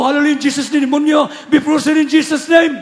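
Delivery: high and loud, casting out the demon; retelling a loud rebuke of the demon; shouted rebuke. Retold or imitated. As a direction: bark it out against the attacker. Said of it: "Sigaw ko na sigaw".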